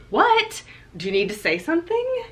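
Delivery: high, sharp, exasperated "What?"; annoyed husband. Retold or imitated. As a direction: play the fed-up husband interrupting. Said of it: "He's like".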